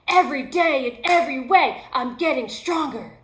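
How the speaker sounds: loud, confident affirmation; quoting Tony. Retold or imitated. Motivational speaker quoting Tony loudly. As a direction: proclaim it like a mantra. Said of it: "He'll say things like".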